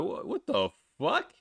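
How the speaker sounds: slow, drawn-out mock outrage; imitating his own stunned anger, high and slow; retold angry disbelief. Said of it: "I got really pissed off here now".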